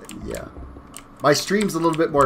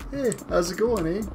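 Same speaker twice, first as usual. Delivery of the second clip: light, friendly "Hey, how's it going, eh?"; sweet as pie, Canadian style; polite Canadian greeting; 5 semitones higher, 4 dB quieter than usual. Retold or imitated. Streamer imitating a polite Canadian greeting, light and friendly. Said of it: "everybody thought Canadians were so polite".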